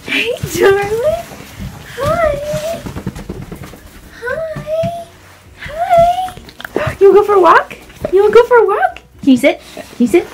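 high, loud excited dog greeting; talking to a dog. Real, not acted. Greeting her big dog excitedly.

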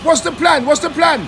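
loud, high shout at the driver. Real, not acted. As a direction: yell it across the street.

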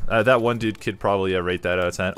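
ordinary delivery

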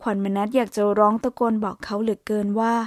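ordinary delivery

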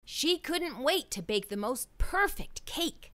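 usual speaking voice

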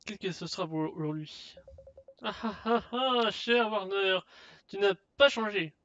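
boisterous merchant. Acted. Merchant boasts of his finds to a customer, boisterous. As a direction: play a hearty shopkeeper hawking rarities.

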